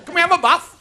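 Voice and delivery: high-pitched hammed-up Northern holler; high, drawn-out mock plea from outside; Northerners calling. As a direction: call out at a closed door in mock dialect. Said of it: "us Northerners, we used to knock on the door".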